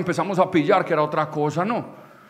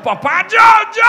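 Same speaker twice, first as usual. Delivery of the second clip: high, rising excited yell; imitating a child clamoring to volunteer; eager kid shouting; 9 dB louder than usual. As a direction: beg to be picked, shrieking it. Kids eagerly volunteering to drunk dad.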